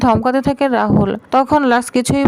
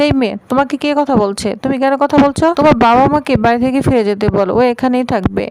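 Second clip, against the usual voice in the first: usual speaking voice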